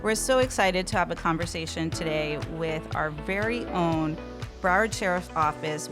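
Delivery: ordinary talk, nothing marked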